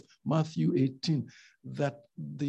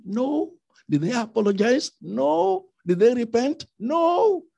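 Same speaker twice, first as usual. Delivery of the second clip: each No louder and higher, building; rising emphatic answers; 7 semitones higher, 6 dB louder than usual. Real, not acted. Preacher to congregation, each No higher and louder. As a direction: hammer home each No, climbing higher each time.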